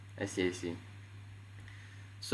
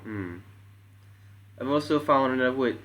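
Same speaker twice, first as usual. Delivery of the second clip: ordinary delivery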